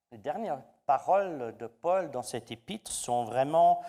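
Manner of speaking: ordinary talk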